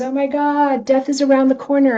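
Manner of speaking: mock panic. Mock panic at social feed.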